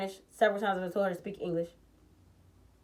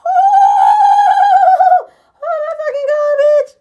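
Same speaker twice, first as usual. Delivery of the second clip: shrieked, much higher and louder; exasperated scream; 16 dB louder, much slower than usual. Real, not acted. Reacting to the host asking for security, shrieking. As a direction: scream your disbelief.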